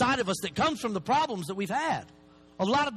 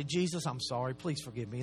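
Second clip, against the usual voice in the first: much softer and lower, a humble apology; hushed prayer; 9 semitones lower, 7 dB quieter than usual. Retold or imitated. Speaker to Jesus after a tantrum, soft and low. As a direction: murmur it low like a penitent.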